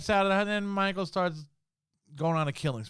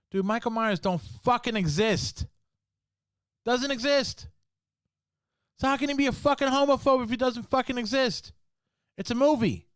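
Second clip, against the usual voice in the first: angry rant; 7 semitones higher than usual. Real, not acted. Vlogger ranting to viewers about the homophobe label, heated. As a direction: vent it, exasperated and fired up. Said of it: "That was my rant".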